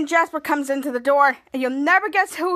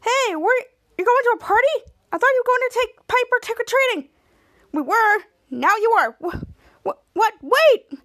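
indignant protest; 8 semitones higher, 4 dB louder than usual. Retold or imitated. Henry protests to his parents.

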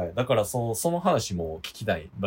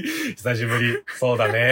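monotone imitation; 3 semitones higher, 6 dB louder than usual. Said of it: "棒読みすぎ".

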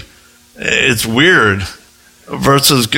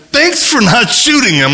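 high-pitched, comic mock gratitude; incredulous thanks; 12 semitones higher, 3 dB louder than usual. Missionary thanking his bodyguard for holding fire, high incredulous thanks. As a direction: squeak out the thanks in disbelief.

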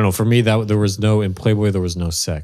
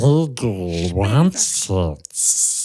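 very slow creature impression; hammed-up Smeagol voice; weird creature voice; much slower than usual. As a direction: drag the words out weirdly. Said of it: "do the weirdest voice you can do".

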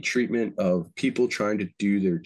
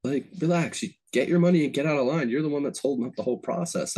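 telling him to chill; 7 semitones higher than usual. Retold or imitated. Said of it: "And I'm like".

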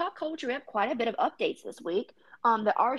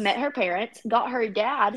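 usual speaking voice

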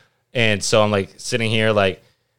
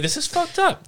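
high-pitched exclaim. Retold or imitated. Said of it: "I'm like".

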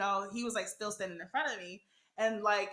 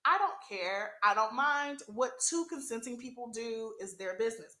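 ordinary talk, nothing marked

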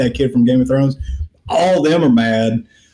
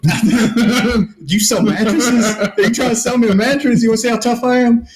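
high, laughing put-on voice of a pushy stranger; goofy high mock voice, giggling through it; mock pushy salesman; 9 semitones higher than usual. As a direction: ham up a shady pitchman while cracking up. Mocking the trench coat guy callback.

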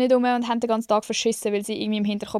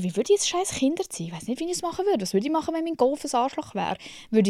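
bewildered inner voice; 6 semitones higher than usual. Retold or imitated. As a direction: wonder aloud, half lost. Imagining herself as parent. (höher und leiser, ratlos vor sich hin)

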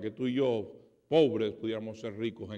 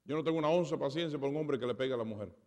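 normal talking voice

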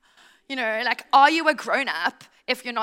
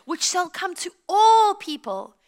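slow, louder herald's announcement; angel's proclamation; 5 semitones higher, 6 dB louder, slower than usual. Acted. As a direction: proclaim it to everyone, slow and projected. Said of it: "cried the angel".

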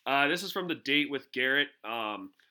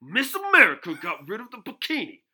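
loud, higher, slow sports-pundit rant; Stephen A Smith; 8 semitones higher, 11 dB louder, slower than usual. Retold or imitated. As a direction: build up like an outraged TV pundit on air. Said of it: "read it in a Stephen A Smith voice".

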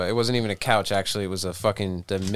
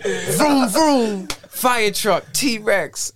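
higher, childlike mock noises; mock tripping ad libs; 9 semitones higher, much slower than usual. Imitating the tripping friend's ad libs, higher. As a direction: babble like a kid, higher.

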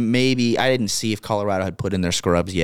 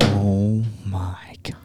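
drawn-out, stunned whisper; awed whisper; much slower than usual. Real, not acted. Host reacting to co-host's bold prediction, stunned whisper. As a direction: whisper it slowly in disbelief.

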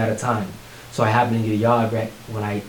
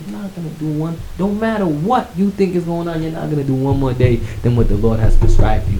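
higher, insistent retold motto; emphatic mentor; 5 semitones higher, 5 dB louder than usual. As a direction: build to a loud, firm reassurance. Mentor's saying acted out emphatically.

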